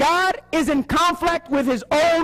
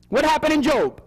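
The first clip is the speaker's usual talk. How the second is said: usual speaking voice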